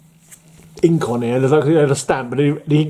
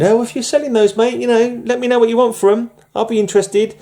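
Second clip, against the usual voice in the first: higher-pitched retold message; casual eager request; 11 semitones higher than usual. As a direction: pitch it up, chatty and keen. Retells own message to seller.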